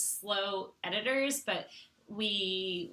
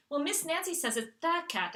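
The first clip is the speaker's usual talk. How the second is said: higher-pitched imitation of a child, THE as "da"; protesting little daughter; 7 semitones higher than usual. Daughter citing her teacher, higher imitated child voice. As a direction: lightly mimic a kid insisting on "da cat". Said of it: "that's why she pronounces it like that".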